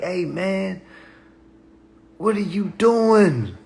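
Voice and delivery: high, drawn-out incredulous question; mock confronting. Somebody confronting the community, in high mock disbelief. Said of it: "somebody need to tell us".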